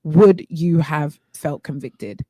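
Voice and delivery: measured challenge to the listeners; deliberate questioning. Real, not acted. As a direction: put the question to them slowly and weightily. Poses grave question to listeners.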